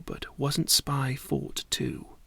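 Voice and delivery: ordinary delivery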